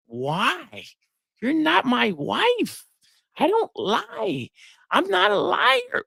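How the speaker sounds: high, slow, offended protest; indignant protest. Retold or imitated. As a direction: act wounded at being doubted. Indignant man defending himself.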